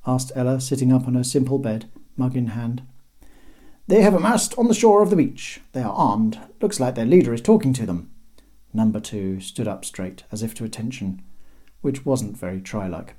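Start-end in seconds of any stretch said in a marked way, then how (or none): 3.9-8.1 higher, tense report of danger; brisk soldier reporting trouble; urgent deputy voice. Acted.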